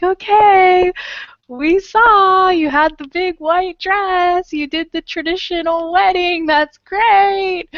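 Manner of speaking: high, sarcastic fake praise. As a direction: gush sarcastically. Mock congratulating friend's wedding posts.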